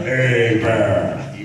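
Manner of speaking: "Hey, Bear!" split in two, H silent; name pronunciation. Shown as a demonstration. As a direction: call "Hey" then "Bear" apart. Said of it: "The H is silent, the E's like an A".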